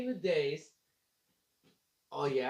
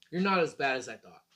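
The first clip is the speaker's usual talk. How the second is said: normal talking voice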